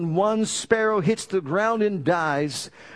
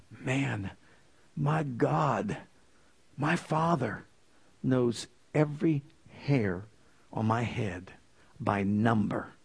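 much softer and lower, slow, reverent; hushed awe; 7 semitones lower, 7 dB quieter, slower than usual. Real, not acted. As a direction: say it as if barely believing it. Awe at God's knowing.

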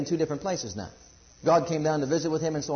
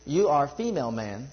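ordinary talk, nothing marked

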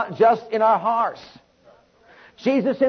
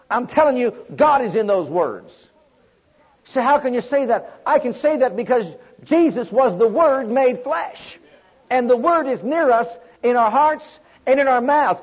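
ordinary delivery

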